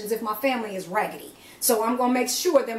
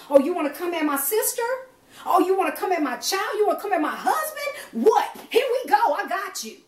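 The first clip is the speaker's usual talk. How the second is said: fierce challenge; 9 semitones higher than usual. Pretend. Preacher voicing herself facing down the enemy, fierce rising taunt. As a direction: square up and dare them to come at your family.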